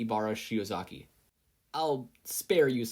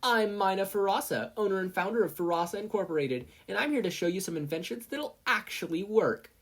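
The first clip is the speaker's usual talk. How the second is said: high, smug inventor voice; one-up her competitor; rival sales pitch; 9 semitones higher than usual. Acted. Mina pitching her inventions, smugly.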